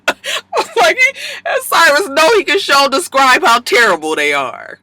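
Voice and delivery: high, breaking with laughter; laughing while talking. Real, not acted. Host cracking up over Cyrus's scheme, laughing. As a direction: laugh through the words. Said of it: "laughing while retelling".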